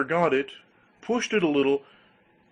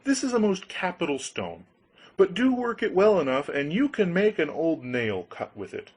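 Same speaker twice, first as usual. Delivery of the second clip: sly grinder voice; 6 semitones higher than usual. Acted. Grinder cons Hans.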